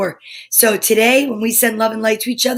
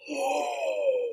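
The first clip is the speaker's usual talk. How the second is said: sharp high gasp; quiet high shocked gasp; 12 semitones higher, 10 dB quieter than usual. Real, not acted. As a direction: catch your breath in shock. Noticing cracked tooth.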